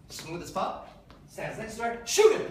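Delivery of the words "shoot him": higher, louder shout; shouted command. Retold or imitated. Old man urging the princess to shoot, loud higher shout. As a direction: yell the order to shoot.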